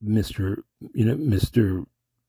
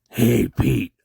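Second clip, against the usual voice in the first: lightly voiced gangster greeting, raised and firm; Mister Eddie; 3 semitones higher, 5 dB louder than usual. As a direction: call out in character, held back from full force. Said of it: "I could do the softer Mister Eddie".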